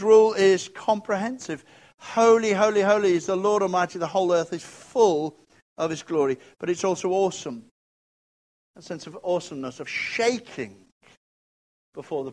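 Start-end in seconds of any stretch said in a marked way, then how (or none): 2.0-6.4 proclaimed scripture. Acted. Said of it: "quoting seraphs' cry".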